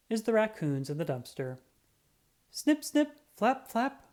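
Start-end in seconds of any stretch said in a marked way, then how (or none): 2.5-4.0 high, bright snip and flap sounds; high snipping noises. Acted. Narrator voicing the bats at the barber, high and quick. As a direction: chirp it like scissors and wings.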